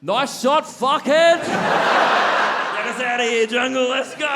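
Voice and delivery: high, loud mock battlefield yell; heckling shout. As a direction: jeer at the enemy, then holler for your mate. Jim heckles enemy, calls his mate.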